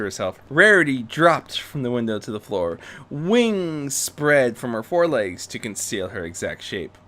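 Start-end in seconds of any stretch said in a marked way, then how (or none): none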